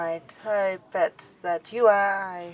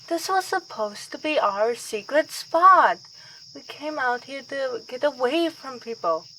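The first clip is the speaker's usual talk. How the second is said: normal talking voice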